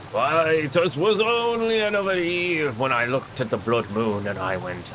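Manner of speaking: hammy pirate-style sea dog; gruff old salt spinning a yarn; old sea captain. Pretend. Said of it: "old-timey sea captain's voice".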